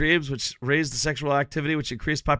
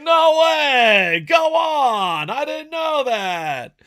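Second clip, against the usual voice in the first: much higher, shouted fake awe, slowing at the end; mock amazement; 10 semitones higher, 3 dB louder, much slower than usual. To chat, acting out how he fakes agreement, shouted. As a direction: overplay being amazed.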